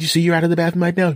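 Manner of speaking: high mock wife voice; imitated wife asking. Voices wife questioning him.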